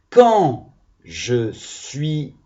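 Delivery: very slow, drawn out "Quand je suis en colère"; slow reading aloud. Shown as a demonstration. Teacher reads the sentence start slowly to the class. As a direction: stretch each word of "Quand je suis en colère". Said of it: "on fait la liaison".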